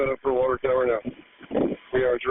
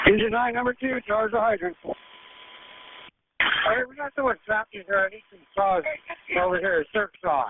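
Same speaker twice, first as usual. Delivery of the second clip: urgent firefighter radio; 12 semitones higher than usual. Real, not acted. Firefighter calling for help inside.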